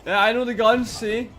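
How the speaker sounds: high, loud proud boast; excited boast. Real, not acted. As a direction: crow about getting it right. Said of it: "proud of knowing the gun".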